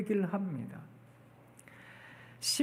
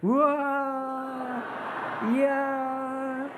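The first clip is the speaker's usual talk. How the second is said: drawn-out wow; 5 semitones higher, much slower than usual. Shown as a demonstration. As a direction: show the congregation how to marvel. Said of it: "또 한 번 더 연습해 볼게요". (길고 크게 감탄하는 우와)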